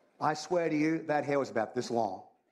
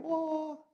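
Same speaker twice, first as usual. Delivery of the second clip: awed oh. Pretend. Imagined sight of angel.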